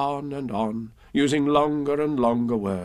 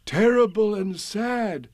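higher, slow lament; mournful Pooh; 9 semitones higher than usual. Acted. Pooh telling Owl bad news, mournful.

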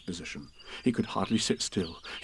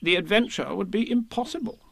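measured, higher pronouncement; solemn; 9 semitones higher than usual. A narrator's reading. Rabbit declares Pooh essential to the adventure, solemn. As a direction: declare it with importance. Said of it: "said Rabbit solemnly".

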